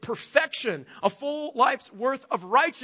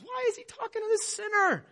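high, incredulous scandalised question; mock-outraged disciple asking why; shocked disciple; 13 semitones higher than usual. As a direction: pitch right up like an appalled onlooker.

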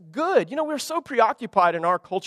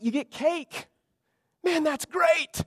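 high, soft, pleased voice on cake; delighted; 9 semitones higher, 4 dB quieter, slower than usual. Acted. Preacher to congregation, gleeful about cake. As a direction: savor the word cake like a treat.

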